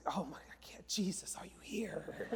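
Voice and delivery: breathless whisper, worn out; whispered despair. Retold or imitated. Parent in the endless line whispers to Jesus in despair. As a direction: whisper it like you're begging for rescue.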